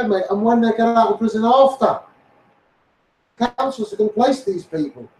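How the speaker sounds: ordinary talk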